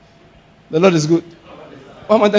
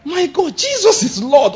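high startled cry of faith; high, surprised outburst; shocked exclamation; 11 semitones higher, slower than usual. Shown as a demonstration. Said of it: "One of the ones I use a lot".

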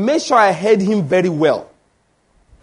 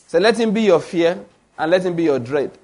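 usual speaking voice

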